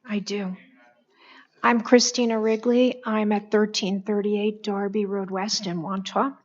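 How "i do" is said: soft unvoiced whisper; barely voiced, just breath. Real, not acted. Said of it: "I have a vocal condition".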